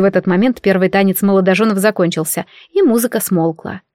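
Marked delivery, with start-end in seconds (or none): none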